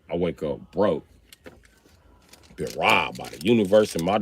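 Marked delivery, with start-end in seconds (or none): none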